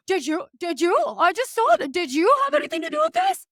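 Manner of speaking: high, rushed mock-innocent voice; feigned innocence. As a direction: imitate him playing innocent, pitch climbing right up. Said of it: "make it seem so innocent".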